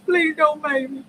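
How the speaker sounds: mock crying. Joking she'd cry over sticker.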